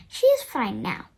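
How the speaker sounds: ordinary delivery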